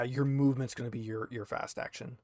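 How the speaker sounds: normal talking voice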